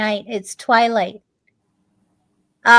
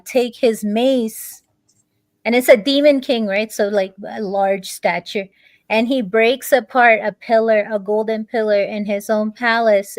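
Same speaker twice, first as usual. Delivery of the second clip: usual speaking voice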